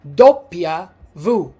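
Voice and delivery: slow "doppia V" with V said voo; "doppia V" as DOP-pya voo, slowly; "doppia V" in Italian, slow and clear. Shown as a demonstration. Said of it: "we call it, in Italian".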